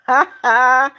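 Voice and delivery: short "ha ha" at someone's expense; gloating laugh. Real, not acted. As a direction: laugh as you send a troll packing. Gloating over reporting a viewer.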